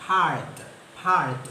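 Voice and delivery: പാഴ്റ്റ് as part, long back aa and no r sound; model pronunciation. Shown as a demonstration. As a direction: say പാഴ്റ്റ് clearly, silent r, back vowel. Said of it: "അപ്പൊ നമ്മളിത് pronounce ചെയ്യേണ്ടത്". (പാഴ്റ്റ് റ ഉച്ചരിക്കാതെ പറയുക)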